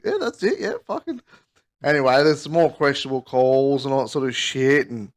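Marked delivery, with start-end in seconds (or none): 0.0-1.2 awkward agreement. Retold or imitated. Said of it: "I'm like".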